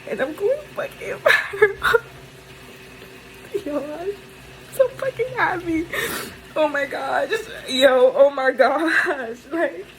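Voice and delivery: high, sobbing through happy tears; overjoyed crying, voice breaking high; laughing and sobbing at once from happiness; real ugly crying. Real, not acted. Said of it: "a clip of me ugly crying".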